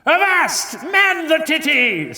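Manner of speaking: high pirate captain shout. Retold or imitated. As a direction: bellow a pirate command. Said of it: "when a pirate says".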